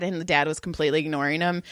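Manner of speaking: ordinary talk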